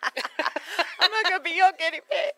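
high, loud, through laughter; squealed laughing refusal. Real, not acted. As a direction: protest while cracking up. Cracking up over green Coke experiment.

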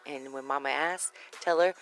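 normal talking voice

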